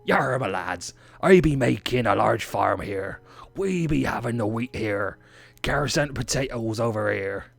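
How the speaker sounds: hammed-up West Country pirate-farmer accent. Host tells his lads about the farm build, mock pirate accent. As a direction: play a rustic English pirate, drawing out the R sounds. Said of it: "that's a pirate".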